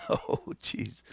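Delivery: breathy little laugh. Real, not acted. Laughs at own fumble.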